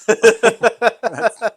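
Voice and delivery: sudden loud laugh; loud burst of laughter. Real, not acted. Laughs at the "died inside" joke.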